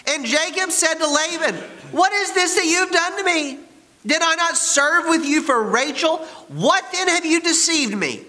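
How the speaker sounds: hammed-up, high-pitched indignation; very high, theatrical accusing questions; outraged protest. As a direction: cry out as the betrayed bridegroom. Reads Jacob's outraged protest dramatically.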